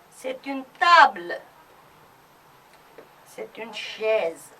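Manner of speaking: slow French sentences with pauses; model reading. Shown as a demonstration. Teacher reads object sentences for class to echo. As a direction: say each one clearly, leave time to repeat. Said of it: "Repeat after me".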